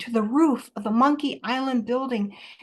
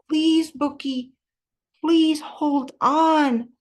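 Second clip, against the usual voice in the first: louder, drawn-out pleading; urgent plea; 3 semitones higher, 4 dB louder, slower than usual. A narrator's reading. Child begs friend clinging to wet roof, urgent plea. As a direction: plead slowly and loudly, stretching the words.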